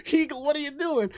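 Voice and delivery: high, exasperated plea; mock exasperated whine; pleading question. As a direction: beg him to explain himself. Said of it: "I'm like".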